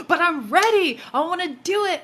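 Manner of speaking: put-on high, loud and eager, like a kid begging; eager protest. To the teacher before the ceremony, high eager pleading. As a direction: plead that you're ready right now. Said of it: "I was like".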